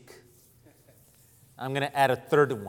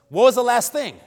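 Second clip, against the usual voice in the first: loud, high-pitched pulpit preaching; full-voiced sermon delivery, raised and emphatic; 7 semitones higher, 11 dB louder than usual. Real, not acted. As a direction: proclaim scripture to the whole congregation in ringing, measured phrases. Reads scripture and preaches to congregation.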